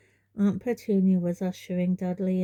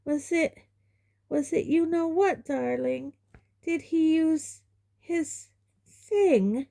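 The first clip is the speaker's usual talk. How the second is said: high, slow fearful whisper-like mother; mother asking son fearfully; Aunt Petunia; 10 semitones higher, slower than usual. A narrator's reading. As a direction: dread of magic.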